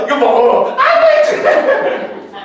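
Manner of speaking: high, loud gushing over the dog; imitating the wife's high delighted fuss; doting wife. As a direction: coo at the pet while feeding it. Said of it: "우리 와이프가".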